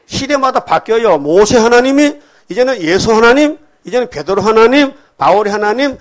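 full-voice sermon cadence; fiery preaching. Real, not acted. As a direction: proclaim it to the congregation.

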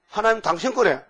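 usual speaking voice

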